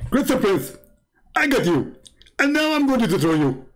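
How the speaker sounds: very high, put-on cartoon caller; squeaky-high mock threat, drawn into falling endings; Zakir Naik. Joking caller ambushes the host as Zakir Naik, high cartoon voice. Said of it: "Somebody is just joking with you".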